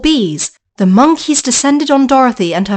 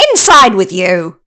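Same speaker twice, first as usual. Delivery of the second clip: witch command; 4 semitones lower, 4 dB louder than usual. Acted. Witch orders Dorothy.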